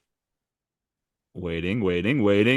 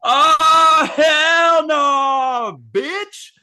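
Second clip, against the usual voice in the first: very high, loud shouting trash talk; mock fury; 12 semitones higher, 4 dB louder than usual. Angel Reese trash-talking the monster, high shouting. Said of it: "Then she goes".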